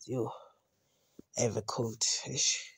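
normal talking voice

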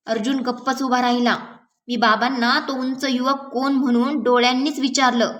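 usual speaking voice

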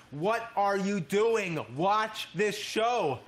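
loud, high, drawn-out exasperated yell; stretched-out shouted demand, pitched high; scream. Pretend. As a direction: scream each word slowly at your younger self. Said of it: "scream in my ear".

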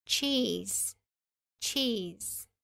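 "cheese" with a tch start and a long ee; cheese demo. Shown as a demonstration. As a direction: stretch the ee in "cheese" and end on a buzzing z. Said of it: "é com a palavra".

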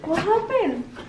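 slow, high cooing questions; tender concern. Real, not acted. Parent asks crying child what happened, slow tender concern.

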